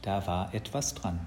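ordinary delivery, nothing marked

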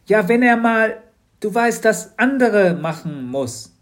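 high, loud, then slowing down; a girl hinting at something awkward; Mathilda's voice. Acted. Mathilda hints shyly. (hohe Mädchenstimme, zögernd langsam)

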